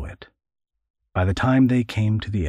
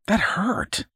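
put-on much higher, wounded boyish complaint; hurt boy; 13 semitones higher than usual. Boy complains about the smack, hurt. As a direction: yelp it like a kid who got hit.

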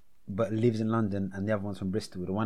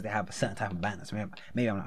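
usual speaking voice

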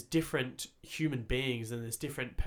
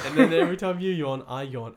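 laughing; 4 semitones higher, 11 dB louder than usual. Real, not acted. Laughing about yawning.

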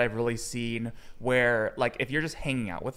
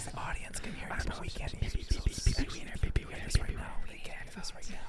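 playful unvoiced ASMR whisper; giggly prank whisper; 3 semitones higher, 9 dB quieter than usual. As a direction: tease in a mock ASMR whisper. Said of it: "It's A S M R now".

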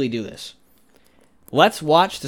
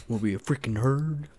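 usual speaking voice

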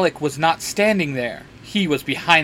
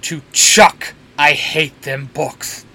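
slow, mildly raised grumble; exasperated shout; 4 dB louder, slower than usual. A narrator's reading. Dean curses the books about him, half-hearted shout. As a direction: draw it out in fed-up annoyance, only half shouted. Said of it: "Dean shouts".

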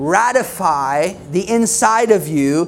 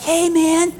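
lightly voiced, higher eager greeting; friendly church greeter; 8 semitones higher than usual. Happy church greeter welcoming a newcomer, higher and eager. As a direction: play the too-friendly greeter at the door. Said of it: "and they were like".

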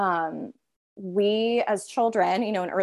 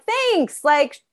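high, slow and louder, brushing the critic off; dismissive brush-off; 7 semitones higher, 6 dB louder than usual. Retold or imitated. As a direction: wave away a nagging voice, drawn out high. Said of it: "I'm like".

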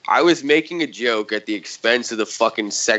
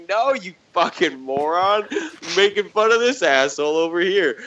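mock retort; 8 semitones higher than usual. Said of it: "It's just like".

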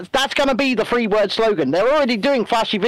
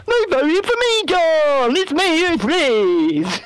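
mock Liz calling; 7 semitones higher, much slower than usual. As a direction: ham up a jilted politician shouting for attention. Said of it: "voicing Liz calling out to John".

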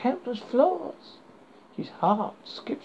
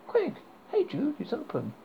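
put-on Californian accent; 6 semitones higher than usual. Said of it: "in a Californian accent".

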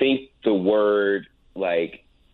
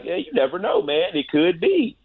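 pitched way up, teasing reassurance; playful encouragement; 10 semitones higher than usual. Retold or imitated. Hosts egging on the anchor about her grandma, high and teasing. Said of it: "And we're like".

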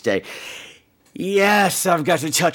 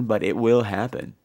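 ordinary talk, nothing marked

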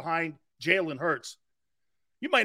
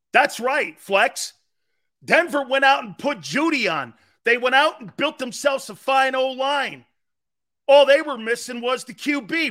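excited yelling; 5 semitones higher, 5 dB louder than usual. Real, not acted. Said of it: "[name], go stop yelling".

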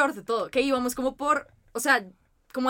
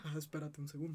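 much lower and softer, lazy mock of the driver; imitating unbothered driver; 9 semitones lower, 18 dB quieter than usual. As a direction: mumble it like you couldn't care less. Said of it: "el señor solamente fue como". (conductor imitado, grave y suave)

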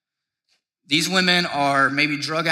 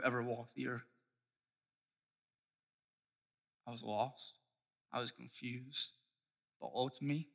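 humble confession; 7 dB quieter than usual. Acted. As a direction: lay your sin bare quietly.

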